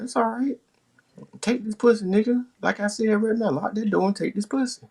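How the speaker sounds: put-on high, eager girlfriend; high woman's voice. Woman inviting him, high and eager. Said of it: "She talking about".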